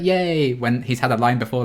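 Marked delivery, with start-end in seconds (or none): 0.0-0.5 mock cheer. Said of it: "He's like".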